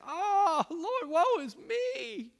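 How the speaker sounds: high, slow, hammed-up wailing lament; mock wail. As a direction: whine it like a melodramatic mourner. Mocking believers who fall apart.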